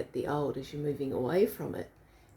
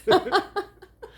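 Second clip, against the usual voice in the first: high laugh; 14 semitones higher, 8 dB louder than usual. Real, not acted. Laughs about pajamas.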